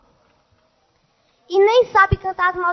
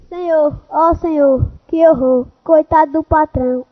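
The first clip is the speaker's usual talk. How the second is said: normal talking voice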